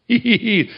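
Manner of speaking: high mock giggle; waitress's high tittering hee hee; imitated giggle. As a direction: mimic her little laugh. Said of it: "when she really laughed".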